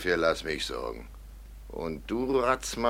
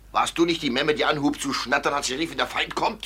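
furious accusation; 7 semitones higher than usual. Acted. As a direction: build to the killing blow. Schweizer tötet Spiegelberg. (wütend steigernd)

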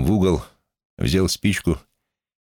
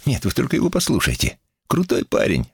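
higher-pitched scoffing; derisive snort; 7 semitones higher than usual. A narrator's reading. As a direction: scoff at the boy's bravado. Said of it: "фыркнул Роллинс". (насмешливое фырканье)

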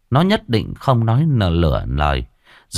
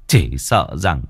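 tearful father pleading. Acted. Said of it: "cúi mặt mà khóc mếu".

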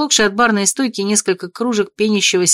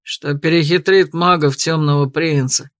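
Garret smirking; 4 semitones lower than usual. A narrator's reading. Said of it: "щербато ухмыльнулся Гаррет".

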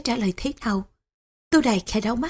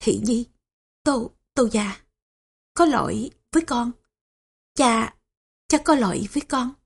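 trembling voice. A narrator's reading. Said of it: "Tô Hổ giọng run rẩy".

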